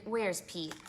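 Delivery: plain reading voice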